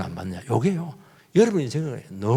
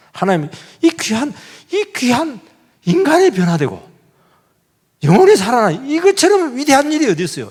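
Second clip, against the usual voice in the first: higher and louder, rising to a peak; fervent preacher, pitch climbing, louder; fervent preaching; 7 semitones higher, 5 dB louder than usual. Real, not acted. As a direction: preach it with fire.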